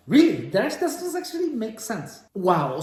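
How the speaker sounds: high, surprised really; pleasantly surprised reaction. Real, not acted. Reacts to rephrased sentence.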